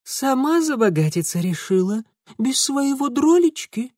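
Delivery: high-pitched mock outrage; fake indignation. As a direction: act wounded, overplaying it. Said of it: "фальшиво возмутился Франт". (притворное возмущение)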